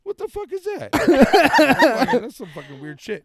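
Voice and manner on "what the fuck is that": much higher alarmed cry; retelling his shock at a laser on his chest; startled. Retold or imitated. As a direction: freak out at the dot. Retold alarm at laser dot.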